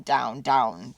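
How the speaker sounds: usual speaking voice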